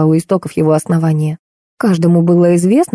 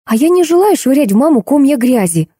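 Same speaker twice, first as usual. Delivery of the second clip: higher-pitched indignant protest, read with restraint; angry hissing, voiced; 7 semitones higher than usual. A narrator's reading. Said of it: "зашипела девушка". (сдержанное злое возмущение, повыше)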